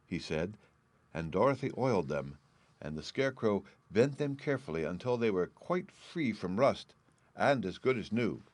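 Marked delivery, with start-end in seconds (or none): none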